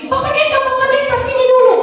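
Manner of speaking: high call across the market; shrill-free high calling out to the pastor. Acted.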